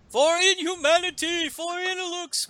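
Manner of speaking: very high mock battle cry; strained, high-pitched rallying call. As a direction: cry it out high like a charging hero. Acted battle cry in retold story.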